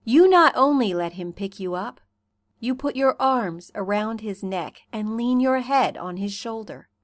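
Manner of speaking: ordinary delivery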